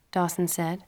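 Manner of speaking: ordinary delivery, nothing marked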